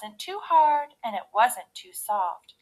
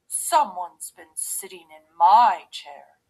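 loud big-bear outrage; roaring father bear; 3 semitones lower, 9 dB louder than usual. A narrator's reading. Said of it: "he roared".

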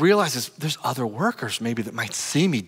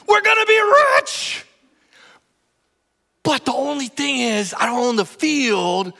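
high, loud, shouting farmer bursting with news; excited husband; 10 semitones higher, 4 dB louder than usual. Retold or imitated. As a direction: blurt the treasure news to your wife. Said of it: "It's something like this".

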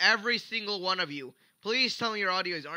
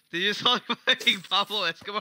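loud, higher, laughing disbelief; laughing outburst; 4 semitones higher, 6 dB louder, faster than usual. Real, not acted. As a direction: blurt it out through a laugh.

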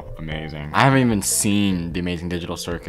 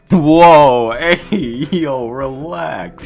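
startled laugh; 8 semitones higher, 11 dB louder, much slower than usual. Real, not acted. Reacting to an insult in clip.

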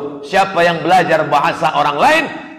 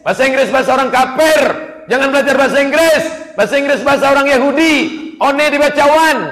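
loud, fast, higher mock preaching of an old-fashioned cleric; mocking a conservative cleric; 7 semitones higher, 5 dB louder, faster than usual. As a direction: parody the hardline preacher forbidding English. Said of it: "Saya tidak suka ustadz konservatif". (ejekan keras dan cepat meniru ustadz kolot)

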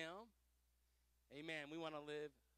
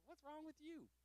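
high, hushed retold question; puzzled aside; 9 semitones higher, 10 dB quieter than usual. Pastor to mad friend mid-worship, high puzzled aside. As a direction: ask it softly, pitched well up.

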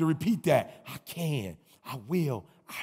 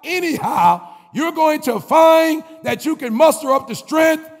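rousing preacher cadence, a bit louder; preaching refrain; 6 semitones higher, 4 dB louder than usual. Acted. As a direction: fire up the graduates like a pastor. Builds a preaching motivational refrain.

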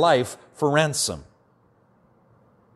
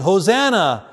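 mock crowd's cheering shout, higher and louder; 5 semitones higher, 5 dB louder than usual. Throng hailing Jesus on the donkey, shouting. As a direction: call it out like one of a celebrating throng. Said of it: "throng of people shouting".

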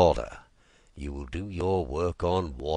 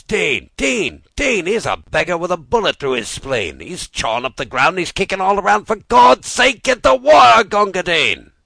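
loud, much higher desperate call, building; urgent shouting; 13 semitones higher, 14 dB louder than usual. Acted. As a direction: plead loudly for water for the wounded. Urgent cry for a wounded man.

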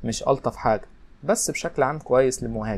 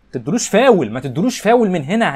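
high, loud shout of alarm; real yell; 7 semitones higher, 7 dB louder than usual. Real, not acted. Gamer yelling at his defenders not to foul, loud alarmed shout. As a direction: shout the warning at the players.

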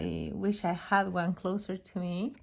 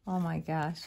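choked up; slower than usual. Real, not acted. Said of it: "you're gonna make me cry".

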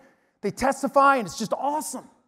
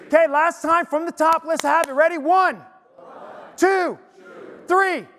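loud, high count-off; rousing chant leader's call; rallying call; 4 semitones higher, 5 dB louder than usual. Real, not acted. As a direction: lead the crowd in a final shout. Leads congregation in final chant.

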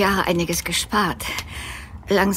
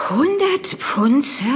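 astonished; 5 semitones higher, slower than usual. Acted. Astonished at the generous offer.

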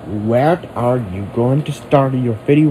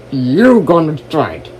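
ordinary talk

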